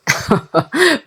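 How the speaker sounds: short high scornful laugh; scoffing laugh. Acted. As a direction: laugh off the idea. Father scoffs at nanny idea. (ضحكة ساخرة قصيرة)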